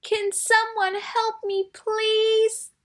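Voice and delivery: high, small plea for help; stuck little elephant crying for help, high-pitched. Acted. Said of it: "a little voice cried out".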